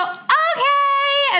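put-on high, drawn-out kid's reply; imitated eager kid. Voicing kids agreeing to visit.

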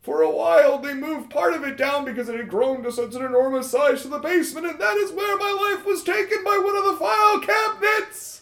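high nebbish ghost voice; a nervous clerk ghost telling his death. Acted. Ghost explaining backstory, high.

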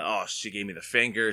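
ordinary delivery

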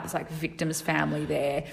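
normal talking voice

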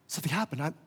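soft, as if to herself; hushed realization. Acted. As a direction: drop to a small, private voice. Imagined healed woman on the ground.

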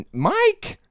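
high, loud scolding call; mom calling out. Retold or imitated. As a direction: call him out like a shocked mom. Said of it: "And she's like".

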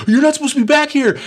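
very high, loud, rushed outburst; imitated scolding yell. Off-duty Mickey Mouse shouts at intruders, imitated scolding. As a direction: yell it fast as a startled mock scold.

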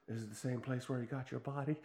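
softly imitated Southern nurse; a quiet, mock-Southern come-on; Southern US accent. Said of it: "like, Alabama and Mississippi".

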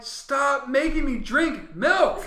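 high, loud, slow plea; mock-desperate protest. Force-fed viewer begs director to stop, loud drawn-out protest. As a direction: drag it out in hammed-up exasperation.

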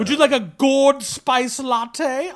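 slow, hammed-up British accent. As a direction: play a leisurely mock Brit offering refreshments. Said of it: "I'm British, can't you tell?".

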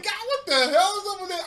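high, loud baffled outburst; acted-out incredulous reaction; mock bewilderment. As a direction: exclaim in exaggerated disbelief. Acts out own baffled reaction.

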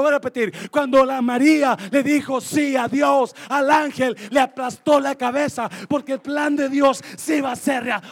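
loud preached proclamation; preaching shout. Acted. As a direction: hammer it home to the congregation.

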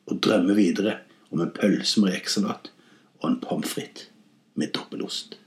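low, fading to a whisper; soft, dreamy murmur dying out; dreamily drifting off. A narrator's reading. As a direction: trail away as if falling asleep.

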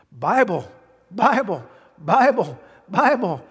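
building emphatic repetition. Real, not acted. Preacher to congregation, repeating Bible with rising force. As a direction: pound the word Bible home, climbing each time.

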